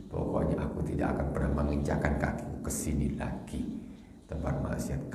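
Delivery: soft, whispered resolution; whispered vow. Retold or imitated.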